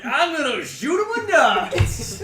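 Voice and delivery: imitating enthusiastic shout. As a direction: overdo the battle cry for laughs. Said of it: "You're like".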